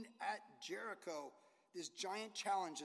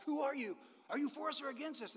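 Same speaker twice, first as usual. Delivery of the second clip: startled Joshua; 7 semitones higher than usual. Retold or imitated. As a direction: jump back and demand who he is. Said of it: "Scares Joshua".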